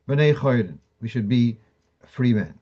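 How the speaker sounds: ordinary talk, nothing marked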